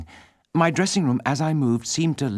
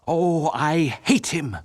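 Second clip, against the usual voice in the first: cried out; 4 semitones higher, much slower than usual. Acted. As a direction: let the hatred burst out, dragging it. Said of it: "cried Raoul".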